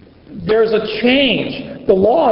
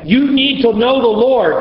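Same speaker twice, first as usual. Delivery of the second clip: lightly mock a parent lecturing a child; parent scolding; 6 semitones higher than usual. Parent lecturing a child about God, light mock scold. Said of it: "Sounds like a parent".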